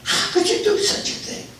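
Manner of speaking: high-pitched mock indignation; appealing to God. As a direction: protest to heaven in a high voice. Golfer's onlooker protests to God.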